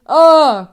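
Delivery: loud chesty scream; chest scream. Shown as a demonstration. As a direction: belt out a short chest scream. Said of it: "chest dominant scream".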